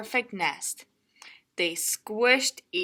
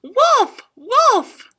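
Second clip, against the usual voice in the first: very loud, high warning shout; crying wolf; 11 dB louder than usual. Acted. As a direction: holler the warning. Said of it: "and he cried".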